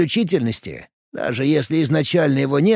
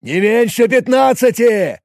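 loud, high battle call; shout; 5 semitones higher, 6 dB louder than usual. Acted. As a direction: yell the count out. Wounded man calling in battle. (громкий выкрик)

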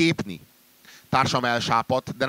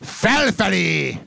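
ecstatic call; 8 semitones higher, 5 dB louder, much slower than usual. Acted. Falling man calls out upward.

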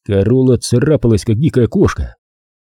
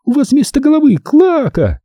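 high shrieking female insult; hysterical yell. Acted. Corola keeps shrieking insults at him. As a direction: keep the hysterical shriek going. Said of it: "истерично завопила она".

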